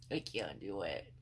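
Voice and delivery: low, soft, slow defeated voice; exhausted groan. Retold or imitated. Quoting herself each morning, low and defeated. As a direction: sound too drained to move.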